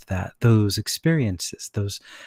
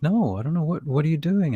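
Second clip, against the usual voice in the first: higher voice for acupuncturist; 7 semitones higher than usual. Retold or imitated. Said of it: "She's like".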